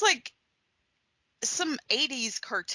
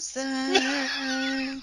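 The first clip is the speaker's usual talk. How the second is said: whispery sing-song voice; 4 semitones higher than usual. Retold or imitated. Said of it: "whispery, sing-song voices calling her name".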